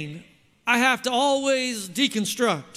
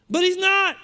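ordinary delivery

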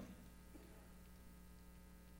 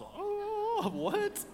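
"Oh, what?" jumps far higher in astonishment; stunned disbelief. Retold or imitated. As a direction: gasp out the shock. Group stunned by his request.

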